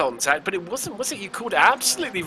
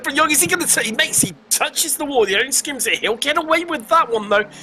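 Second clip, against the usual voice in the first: excited; 8 semitones higher than usual. Real, not acted. Near miss with wall.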